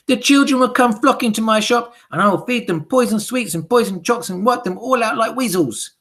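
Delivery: high, loud gleeful hag; excited witch shouting. Acted. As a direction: blurt out the plan with wicked glee. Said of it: "leapt to her feet and shouted".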